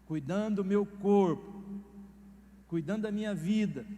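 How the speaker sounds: slow triumphant self-praise; proud satisfaction. Retold or imitated. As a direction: savor the duty done. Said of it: "mas a sensação".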